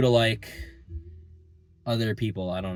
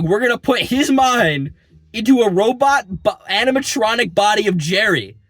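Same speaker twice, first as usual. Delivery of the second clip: mock villain announcement; 9 semitones higher, 7 dB louder than usual. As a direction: boom it out like a cartoon villain revealing the scheme. Villains announce plan to use Jerry.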